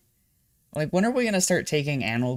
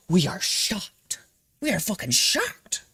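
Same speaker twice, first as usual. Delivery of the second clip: mock shock; 7 semitones higher, slower than usual. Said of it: "mock gasp".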